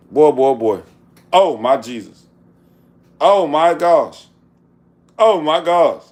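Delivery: loud, drawn-out amazement; awed shouting over the food; amazed. Real, not acted. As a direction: marvel at the finished cornbread.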